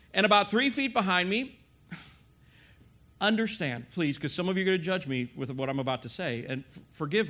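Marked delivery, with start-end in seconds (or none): none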